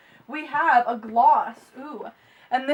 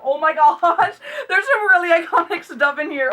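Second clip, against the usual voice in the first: excited; 5 semitones higher, 5 dB louder than usual. Real, not acted. Excited unboxing, laughing.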